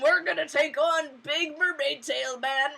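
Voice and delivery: mock bravado. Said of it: "like, 'Mm".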